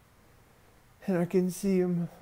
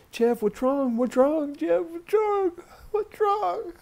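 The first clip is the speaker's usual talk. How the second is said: alarm climbing higher with each question; panicked; 11 semitones higher, slower than usual. Retold or imitated. Mother begging child to say what's wrong, panicked. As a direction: repeat it urgently, pitch rising steeply.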